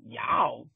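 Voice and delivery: Y'all barked out low and loud; stressed y'all. Retold or imitated. Retelling a coworker's gripe, Y'all dropped low and loud.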